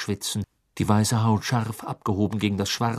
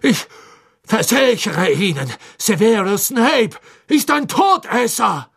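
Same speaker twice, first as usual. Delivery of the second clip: high, loud pleading; desperate insisting; 11 semitones higher, 8 dB louder than usual. Acted. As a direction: insist frantically. Karkaroff pleads, chained. (verzweifeltes Beteuern)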